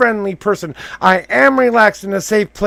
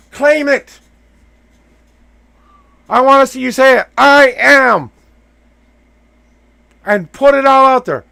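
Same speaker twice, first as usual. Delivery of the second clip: loud, higher, insistent push; emphatic urging; 3 semitones higher, 7 dB louder than usual. Real, not acted. Coach pushing client to claim it, loud and high. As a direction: drive him to shout it out.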